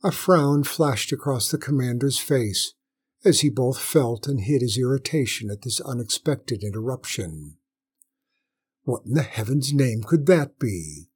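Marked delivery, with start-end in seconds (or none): none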